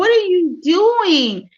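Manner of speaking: high, loud, drawn-out retold outcry; alarmed protest. Retelling her protest to the neighbor, alarmed and loud. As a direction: protest in disbelief, stretching it out. Protests being pushed into car.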